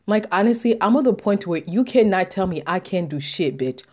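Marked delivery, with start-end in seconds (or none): none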